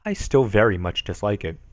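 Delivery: normal talking voice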